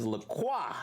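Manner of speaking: "La Croix" said "la kwa", silent X; correct French La Croix. Shown as a demonstration. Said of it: "The I and the X is silent".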